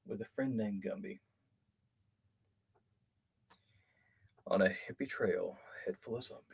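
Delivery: low hushed mock tale voice; softly spun mock yarn; hushed storytelling. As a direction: tell it like a spooky campfire story. Mock spooky storytelling intro.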